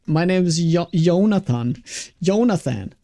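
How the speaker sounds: "Jonathan" said as "Yonathan"; Swedish J-as-Y. Retold or imitated. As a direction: retell introducing yourself, starting "Jonathan" with a Y. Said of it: "He pronounces J's like Y's".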